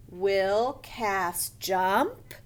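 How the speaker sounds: slow reading, JUMP swooping up as a question; asking. Shown as a demonstration. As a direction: ask it, voice climbing on JUMP. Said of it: "That is asking".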